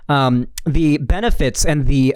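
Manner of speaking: ordinary talk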